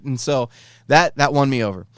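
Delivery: usual speaking voice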